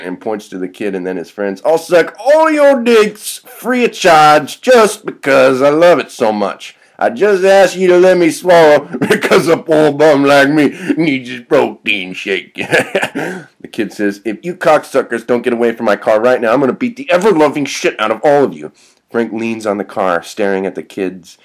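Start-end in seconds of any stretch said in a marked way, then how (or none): 1.6-12.6 high, loud hobo voice; drawn-out, over-eager character voice; gleefully shameless bum. Acted. 12.6-13.4 high cackle; gleeful put-on laugh; character laugh. Frank gloats in character. 14.2-18.7 louder, higher, quickening threat; heated tough-kid ultimatum; angry threat. Acted. Kid threatens to beat them.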